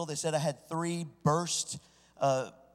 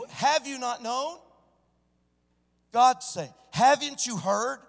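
higher, slow, emphatic question; raised, insistent scripture question; pressed-home question; 7 semitones higher, 3 dB louder, slower than usual. Shown as a demonstration. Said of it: "You didn't hear me".

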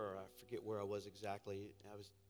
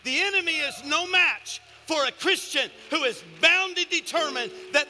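high, shouted sermon climax, easing at the very end; full-force preaching; 21 dB louder than usual. Real, not acted. Preacher declaring the enemy no match for praying believers, shouted fervour. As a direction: cry it out to the whole church with punched key words.

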